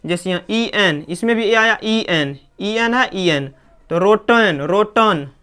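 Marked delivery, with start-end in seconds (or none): 4.0-5.3 रोटन as "ROH-tun"; correct pronunciation. Shown as a demonstration. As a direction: say रोटन with a short rounded-to-neutral vowel before n. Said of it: "ना कि रोटेन".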